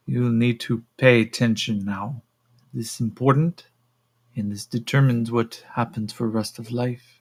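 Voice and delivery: put-on stern woman with a Russian accent; measured, Russian-accented warning. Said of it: "the Altarians are now Russian".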